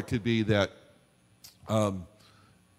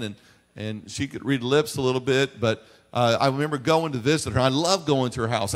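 usual speaking voice

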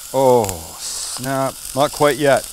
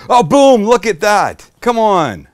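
high, loud burst of delight at the plate; thrilled loud boom; 7 semitones higher, 9 dB louder, slower than usual. Real, not acted. Cook shows off the finished bowl, loud and thrilled. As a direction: shout it out, amazed at your own dish.

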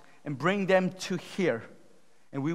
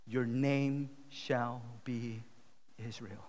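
slow, soft, lowered voice; solemn declaration; 4 semitones lower, 7 dB quieter, slower than usual. Acted. Elijah naming the tribes of Israel, hushed and solemn. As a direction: pronounce it gently and deliberately.

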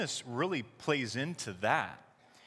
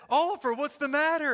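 worried dad; 12 semitones higher, 5 dB louder than usual. Retold or imitated. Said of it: "And I said".

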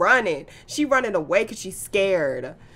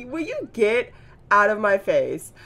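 louder, put-on voice of a snooty celebrity; mock annoyed celebrity; 4 dB louder than usual. Vlogger voicing Joanna brushing off her Sim, mock haughty. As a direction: shoo the pest away in a haughty voice. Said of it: "She's like".

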